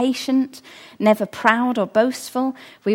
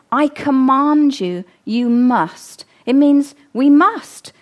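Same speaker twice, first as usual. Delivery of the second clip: louder, driving home the command; preaching at full force; 3 semitones higher, 6 dB louder, slower than usual. Real, not acted. Preacher urging the congregation, rising to a forceful must.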